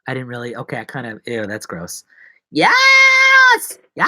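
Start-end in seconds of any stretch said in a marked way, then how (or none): 2.5-4.1 very high, loud drawn-out yell; high scream. Pretend. Host bursting out after eating, high scream. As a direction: scream it long and piercingly high.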